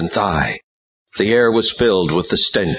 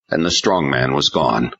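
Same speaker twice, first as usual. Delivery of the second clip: plain reading voice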